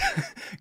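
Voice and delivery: high squeaking laugh; squeaky laugh. Real, not acted. Laugh after accent.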